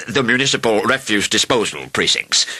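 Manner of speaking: throat-clearing, self-important councillor; officious councillor's voice; presentable official wording. A narrator's reading. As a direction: clear the throat and announce it formally. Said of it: "trying to make it sound a bit more presentable".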